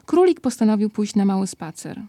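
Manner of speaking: ordinary delivery, nothing marked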